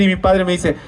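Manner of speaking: ordinary talk, nothing marked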